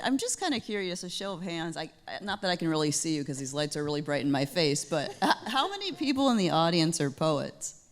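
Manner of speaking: quick, laughing questions to the crowd. Real, not acted. Moderator polling the audience, laughing as she asks. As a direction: giggle through the question.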